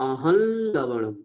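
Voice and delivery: আহল লবণম said slowly, nasal ল held; nasal l pronunciation. Shown as a demonstration. Teacher showing the correct pronunciation, slow. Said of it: "তাহলে উচ্চারণ কি হবে?".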